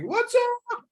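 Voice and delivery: over-the-top, very high hyper greeting. Shown as a demonstration. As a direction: shoot far too high with the energy. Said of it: "I'm not up here".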